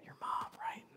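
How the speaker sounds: hushed conspiratorial whisper. Acted. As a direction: lean in and whisper it. Conspiratorial aside.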